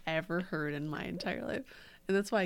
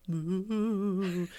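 ghost moo; 4 dB louder than usual. Retold or imitated. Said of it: "instead of being like".

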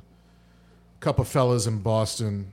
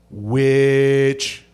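long drawn-out accusing call; witch-hunter's droning verdict; mock accusation. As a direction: stretch it out on one held note. Mock witch-hunt verdict after pause.

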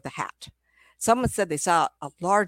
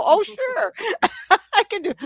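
high, louder, giggling agreement; laughing reply; 14 semitones higher, 5 dB louder than usual. Retold or imitated. Medium answering the aunt's spirit, high and giggly. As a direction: laugh through an eager yes.

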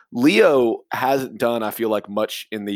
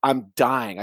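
ordinary delivery

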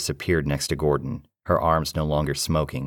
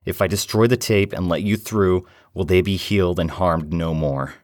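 urgent bargaining; 4 semitones higher, 4 dB louder than usual. Acted. Bargaining with entity, in pain.

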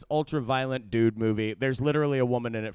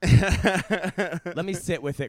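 joking; 5 semitones higher, 4 dB louder than usual. Pretend. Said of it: "I'm kidding".

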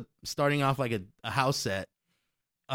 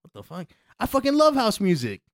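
excited; 7 semitones higher, 5 dB louder than usual. Retold or imitated. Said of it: "Tim voiced excited".

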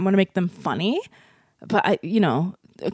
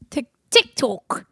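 mock Australian; much slower than usual. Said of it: "Australian accent off".

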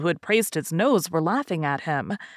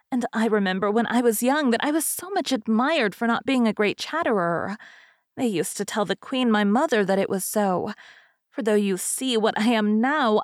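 higher-pitched, rambling fairy hostess; chatty fairy; 4 semitones higher than usual. Acted. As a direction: prattle on as a self-satisfied old fairy. Narrator voices the rambling fairy.

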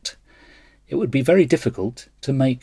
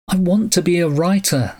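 lamenting; 7 semitones higher than usual. A narrator's reading. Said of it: "Samuel Stern lamented".

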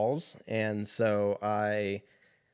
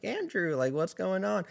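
high sweet neighbor voice, a touch mock; 8 semitones higher than usual. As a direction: mimic the chirpy neighbor lady, pitched way up. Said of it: "she's like".

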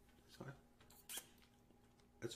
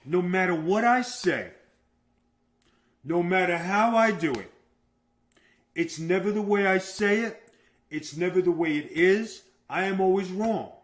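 louder, emphatic list of complaints; loud grievance; 2 semitones higher, 7 dB louder than usual. Real, not acted. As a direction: protest each point forcefully to your partner. Mock-aggrieved quarrel with partner.

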